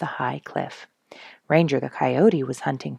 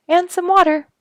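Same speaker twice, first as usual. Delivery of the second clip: put-on eager child; 9 dB louder than usual. Mary adds eagerly.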